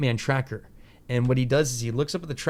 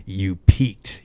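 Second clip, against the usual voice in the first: a louder, smug Bruce Wayne imitation; 4 semitones lower, 7 dB louder than usual. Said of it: "Bruce Wayne voice".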